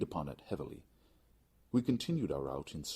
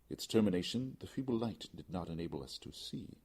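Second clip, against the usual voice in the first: usual speaking voice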